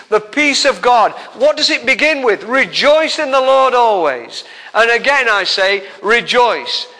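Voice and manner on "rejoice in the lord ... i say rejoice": ringing proclamation of the verse; scripture reading. Acted. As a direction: declare it boldly. Quotes the verse again.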